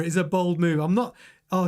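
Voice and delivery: ordinary talk, nothing marked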